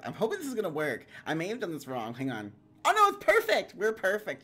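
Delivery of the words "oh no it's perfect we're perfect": loud, high excited burst; delighted relief. Real, not acted. Streamer finding the setup works after all, delighted relief.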